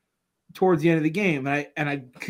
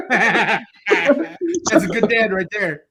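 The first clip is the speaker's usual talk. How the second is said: slow and louder, through laughter; laughing; 6 semitones higher, 4 dB louder, much slower than usual. Real, not acted. Praising the dad in the TikTok story to the hosts, laughing it out slowly. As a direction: savor it while still cracking up.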